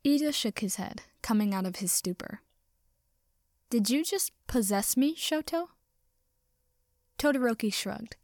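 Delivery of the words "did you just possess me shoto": shocked question, Shoto rising high; stunned disbelief at Shoto; surprised. A narrator's reading. Shocked at being possessed.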